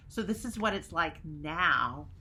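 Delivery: usual speaking voice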